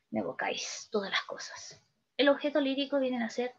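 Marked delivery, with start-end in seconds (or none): 0.1-1.8 whispered verse. Acted. Teacher reciting a poem to the class, in a whisper. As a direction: whisper the line, soft and intimate.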